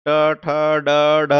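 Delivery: ट, ठ, ड, ढ as separate retroflex syllables with held vowels; retroflex group demo. Shown as a demonstration. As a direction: sound out ट, ठ, ड, ढ on a level tone. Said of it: "एक ही जगह से प्रोनाउंस होता है". (ट, ठ, ड, ढ का अलग-अलग मूर्धन्य उच्चारण)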